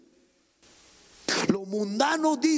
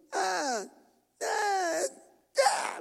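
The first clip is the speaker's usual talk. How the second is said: mock child shriek; 10 semitones higher, 5 dB quieter, much slower than usual.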